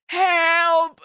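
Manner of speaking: loud, high, drawn-out call; mock cry for help. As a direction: playfully wail for rescue. Mock cry for help, then laughs.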